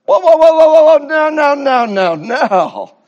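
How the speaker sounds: high loud whoa, waving off the title; playful loud refusal; loud mock protest. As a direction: shout down the compliment in jest.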